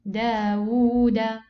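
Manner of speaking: Dawuda slow and loud, with a long uu; two-waw demonstration. Shown as a demonstration. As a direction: hold the wuu in Dawuda as for two waws. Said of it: "pronounce it exactly like this".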